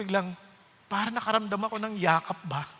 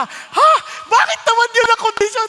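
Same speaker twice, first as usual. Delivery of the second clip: loud, high "Ha?" and indignant question; shocked protest; 14 semitones higher, 12 dB louder than usual. Retold or imitated. Protesting the Lord's condition, loud and shocked. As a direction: cry out in disbelief.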